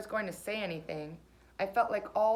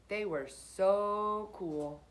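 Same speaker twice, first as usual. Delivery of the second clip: slow admiring drawl; much slower than usual. A narrator's reading. Narrator admiring old friends turned cool, slow drawl. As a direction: stretch it out slowly, impressed.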